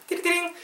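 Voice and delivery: very high sing-song "ding-a-ling" jingle; mouth-made tip-time chime; imitated chime. Shown as a demonstration. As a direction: voice a little segment jingle, high and ringing. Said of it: "발음 팁 타임".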